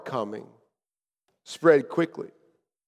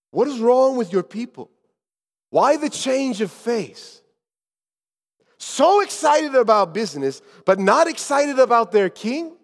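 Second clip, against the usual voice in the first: raised, higher-pitched challenge; challenging questions; 6 semitones higher, 4 dB louder than usual. Acted. Traveler questioning why the city fell silent, challenging. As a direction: press them with pointed questions.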